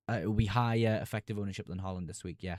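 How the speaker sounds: ordinary delivery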